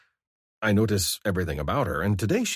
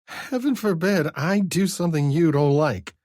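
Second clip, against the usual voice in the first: higher, louder sarcastic woman's voice; 11 semitones higher, 5 dB louder than usual. A narrator's reading. Vivi mocking Scar's complaint, sarcastic and higher. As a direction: throw it back at him, mocking.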